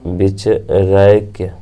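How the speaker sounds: बिचरैक्य stretched slowly, each syllable clear; slow, drawn out. Shown as a demonstration. Teacher repeating बिचरैक्य slowly for students.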